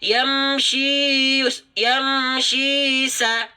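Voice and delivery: yamshi sa drawn out slowly; slow recitation. Shown as a demonstration. Teacher models recitation for viewers, slow. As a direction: recite yamshi sa with two-beat lengthening. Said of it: "ayunkan satu kali untuk dua harokat".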